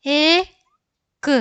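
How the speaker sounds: very slow, high held vowel, separate k; letter by letter. Shown as a demonstration. Teacher sounding out a word for learners, letter by letter. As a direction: stretch the vowel, then release the consonant.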